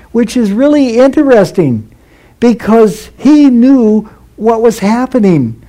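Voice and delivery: normal talking voice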